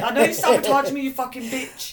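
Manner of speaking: loud playful outburst; mock angry shout. Real, not acted. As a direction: yell it at a friend in jest. Playful anger at friend.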